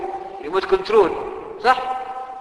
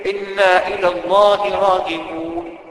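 slow verse recitation; 3 semitones lower, slower than usual. Acted.

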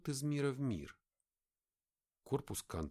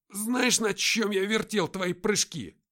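much louder, higher, slow rasp; angry grating; 11 semitones higher, 10 dB louder, slower than usual. A narrator's reading. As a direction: grind it out in anger. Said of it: "гневно проскрежетал Артём".

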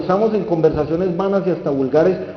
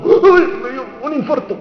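high, hammed-up cry of distress; mock heart attack; 6 semitones higher, 4 dB louder than usual. Pilot having a heart attack mid-flight, mock distress.

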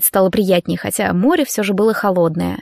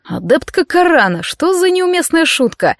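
higher-pitched mock outrage; mildly indignant; 8 semitones higher than usual. A narrator's reading. Dorian scolding a cadet for her joke, mildly indignant. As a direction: rise in light indignation on the question. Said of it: "возмутился Дориан".

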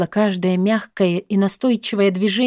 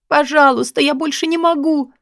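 high, exhausted begging; moaned plea; 7 semitones higher than usual. A narrator's reading. Woman at the end of her endurance, moaning plea. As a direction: moan it out in pain, pitched high. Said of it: "простонала осипшим голосом".

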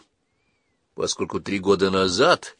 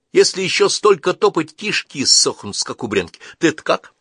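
higher, louder hungry wanderer; 9 semitones higher, 5 dB louder than usual. Acted. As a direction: grumble about long walk hungry. Калик о голоде. (голодный странник, выше и громче)

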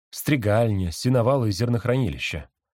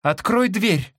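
very high demanding voice; sister voice; 11 semitones higher, 3 dB louder, much slower than usual. A narrator's reading.

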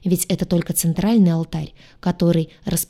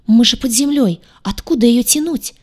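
higher, louder panicky protest; panicked; 5 semitones higher, 5 dB louder than usual. A narrator's reading. Кейра protests to Гадюша underground, panicked. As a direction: blurt it out in rising panic. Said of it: "запаниковала она".

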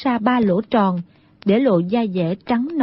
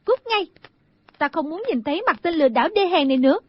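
high-pitched, unraised female rebuke; high outburst, then fast scolding; angry yelling; 6 semitones higher, much faster than usual. Acted. Girl sending the impostor away, angry. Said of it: "Đinh Đan tức giận quát lên".

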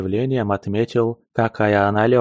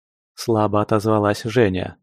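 ordinary delivery, nothing marked

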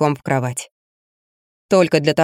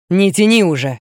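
growled. Acted. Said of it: "прорычал".

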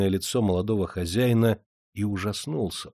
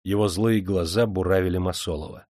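ordinary delivery, nothing marked

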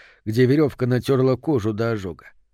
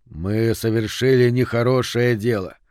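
ordinary delivery

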